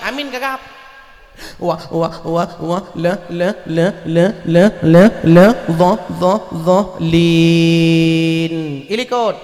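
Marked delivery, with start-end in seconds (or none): 1.6-8.5 low stammered wa, la, dha; stuttering imam. Pretend. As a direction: stammer up to dhalin, getting louder.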